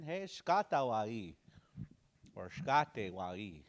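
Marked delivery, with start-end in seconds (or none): none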